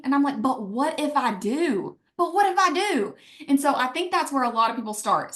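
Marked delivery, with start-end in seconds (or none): 2.2-3.1 pitch jumps up on the repeat. Retold or imitated. Answering her doubtful husband, hopeful defiance pitched high. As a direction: repeat "But what if I do?" much higher, hopeful and insistent.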